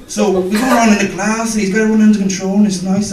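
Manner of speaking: ordinary delivery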